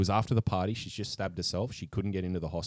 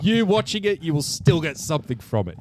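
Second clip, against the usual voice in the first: loud, high insistence; emphatic; 9 semitones higher, 8 dB louder than usual. Real, not acted. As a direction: push the point hard and high. Insisting to a doubtful friend.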